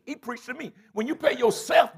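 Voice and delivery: usual speaking voice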